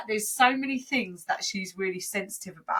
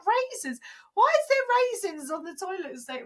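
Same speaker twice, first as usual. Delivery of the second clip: confused mum; 11 semitones higher than usual. Retold or imitated. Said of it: "She was like".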